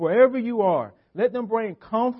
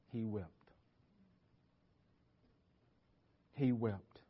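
much softer, lower, solemn; hushed; 8 semitones lower, 13 dB quieter than usual. Real, not acted. Preacher to congregation on Jesus weeping, a hushed solemn voice. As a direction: let it fall almost to nothing.

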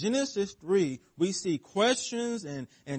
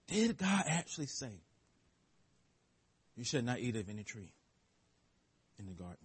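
low and much softer, fading to nearly nothing; sly, soft serpent question; 6 semitones lower, 9 dB quieter, faster than usual. Shown as a demonstration. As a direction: let the question slither out quietly. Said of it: "Notice the subtleness, the cleverness".

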